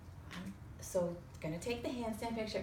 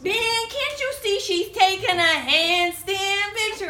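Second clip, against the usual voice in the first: much higher, louder, slow indignant shout; retold yell; 11 semitones higher, 14 dB louder, slower than usual. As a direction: yell at the guy walking in front. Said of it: "they're yelling".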